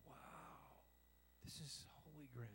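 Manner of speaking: whispered Wow, soft holy ground; awed whisper. Retold or imitated. Believer recognising God, whispered awe. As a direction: breathe it in hushed awe. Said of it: "we go".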